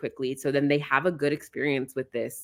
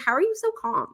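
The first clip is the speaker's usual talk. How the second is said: high, wondering friend voice; lightly voiced impressed friend; mock amazement, pitched up; amazed friend; 13 semitones higher than usual. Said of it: "And she's like".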